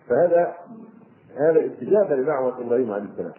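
ordinary delivery

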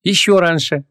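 high, firm proclamation; adamant. Acted. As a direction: declare it without giving way. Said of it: "непреклонно провозгласила девушка".

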